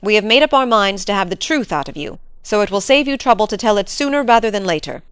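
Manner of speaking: master pressing for truth; stern interrogation, building; stern Sir Henry. Acted. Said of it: "said Sir Henry sternly".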